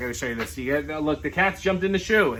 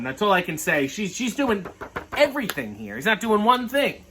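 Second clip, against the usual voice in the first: usual speaking voice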